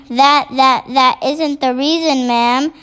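ordinary delivery, nothing marked

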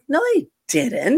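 high, scornful comeback; indignant retort. Retold or imitated. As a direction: snap back in disbelief. Arguing back at people.

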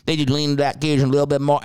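imitated thick Cajun, run together quickly; Cajun accent. Host mocking locals hiding their talk from outsiders, Cajun accent. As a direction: lean into a Cajun accent, words rushed. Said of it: "when they don't want people to know".

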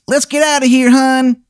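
very high-pitched cartoon character voice; Shithead Steve. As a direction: squeal it out in a put-on falsetto. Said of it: "And he goes".

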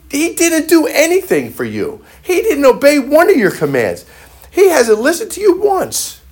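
high, aggrieved voice of the dutiful son; indignant older brother. Retold or imitated. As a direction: play the jealous son protesting his record. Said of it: "and he says".

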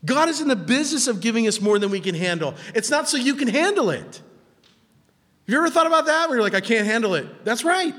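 high, loud preacher's voice; impassioned preaching. Real, not acted. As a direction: preach it at full energy.

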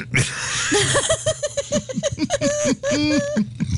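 high, long burst of laughter. Real, not acted. Long laugh after joke.

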